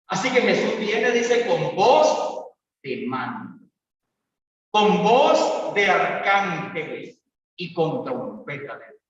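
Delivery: ordinary talk, nothing marked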